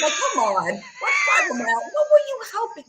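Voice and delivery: shrieked high and loud in disbelief; outraged shout. Real, not acted. Ranting at Heidi on stream, furious high shout. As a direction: yell it at her, furious. Angry rant at rival.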